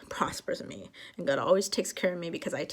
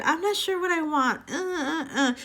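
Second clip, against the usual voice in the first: high, whiny mock dithering; wishy-washy; 8 semitones higher than usual. Mocking someone unsure what to ask God, wishy-washy. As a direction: mimic someone who can't make up their mind. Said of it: "do the wishy-washy".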